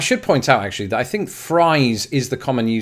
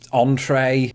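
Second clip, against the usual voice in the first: ordinary talk, nothing marked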